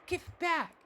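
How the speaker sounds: put-on very high wailing child's voice; shrill child demand. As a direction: push the demand up into a tantrum. Child repeats demand, escalating.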